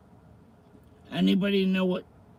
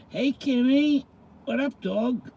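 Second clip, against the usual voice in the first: high, loud hey to a viewer; bright shout-out to a fan; excited greeting; 8 semitones higher, 4 dB louder than usual. Real, not acted. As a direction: call out to Kimmy with a lift.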